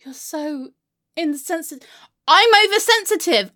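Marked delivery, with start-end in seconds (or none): 2.3-3.5 loud, high, incredulous echo; offended retort. Acted. Repeating friend's accusation back, offended retort. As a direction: throw the accusation back indignantly.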